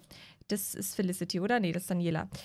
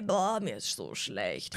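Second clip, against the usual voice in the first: lower, softer, slow queasy moan; lower, slower nauseous moan; 7 semitones lower, 6 dB quieter, much slower than usual.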